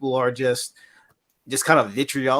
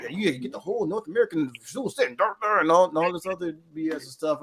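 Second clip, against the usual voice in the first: snobby collector; 3 semitones higher than usual. Retold or imitated. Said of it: "those people who be like".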